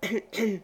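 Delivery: a pointed little cough; soft mock throat clearing; knowing throat clearing. A narrator's reading. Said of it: "la dame de grand mérite qui toussait".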